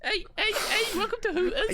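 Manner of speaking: very high, put-on Scouse-American greeting; Scouse-American accent. Imagined Scouse server welcoming customers, high mock American accent. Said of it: "a Scouse person doing an American accent".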